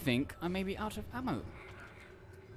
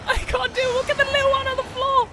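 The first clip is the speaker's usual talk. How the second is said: high, fast frightened pleading; panicked high voice; 8 dB louder, faster than usual. Real, not acted. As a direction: squeal it in fear. Terrified reaction in horror game.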